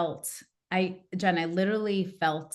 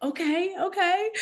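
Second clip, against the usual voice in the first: "okay, okay" much higher, giving in; high resigned okay; 13 semitones higher than usual. Retold or imitated. Parents giving in to their daughter, high and resigned. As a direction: cave in with a high, surrendering okay. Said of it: "they're like".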